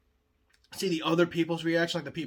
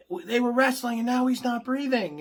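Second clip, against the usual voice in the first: high, loud frantic report; panicked caller; 9 semitones higher, 6 dB louder than usual. Acted. Relative on the phone with nine one one, panicked. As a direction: blurt it out in acted panic.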